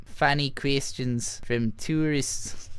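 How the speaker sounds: slow, badly faked Aussie accent; hammed-up Australian vowels, slow. Shown as a demonstration. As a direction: draw the words out in clumsy Australian. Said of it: "in a bad Australian accent".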